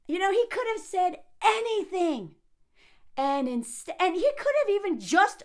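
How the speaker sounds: high-pitched, exasperated insistence; exasperated, high on "anything"; indignant emphasis. Real, not acted. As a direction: push the voice up high in protest. Indignant rant continues.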